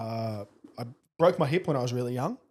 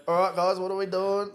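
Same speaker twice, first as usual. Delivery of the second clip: a light imitation of dad's casual group call; kid's impression of dad; 6 semitones higher than usual. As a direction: toss it out like a dad hooking his thumbs in his pants. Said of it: "do an impression of me?".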